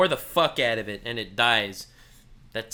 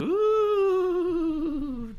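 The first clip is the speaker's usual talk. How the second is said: high wincing ooh; 13 semitones higher than usual. Real, not acted. Streamer winces at the clip.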